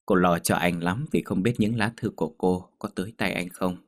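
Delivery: ordinary delivery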